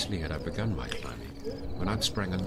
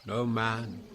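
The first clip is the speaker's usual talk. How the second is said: Virgil's reply; 6 semitones higher than usual. Acted. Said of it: "he answered".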